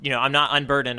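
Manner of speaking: normal talking voice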